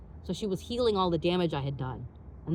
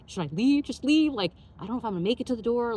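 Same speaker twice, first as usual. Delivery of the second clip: higher, louder, rushed panic; mock-desperate, climbing in pitch; retold panic; 5 semitones higher, 4 dB louder than usual. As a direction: blurt it out in a bathroom emergency.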